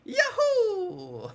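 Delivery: very high, loud cartoon whoop; Mario. Streamer auditioning for the Mario part, falsetto cartoon whoop. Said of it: "You sound like you're auditioning for Mario".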